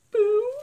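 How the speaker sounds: sharp gasp and high playful boo; mock-spook the viewer; gasp then squealed boo. Reacting to the spider card, gasping playful boo.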